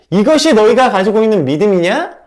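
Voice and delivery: loud stern rebuke. Acted. Jesus rebuking the disciples, loud stern rebuke. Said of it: "지금 이렇게 책망하고 있는 거죠".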